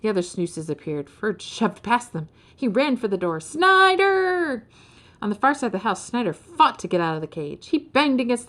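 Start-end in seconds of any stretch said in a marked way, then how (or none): 3.4-4.7 high, drawn-out scream of a name; long calling scream. A narrator's reading. Ferd running for the door, screaming for Snyder. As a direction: holler the name and hold it out.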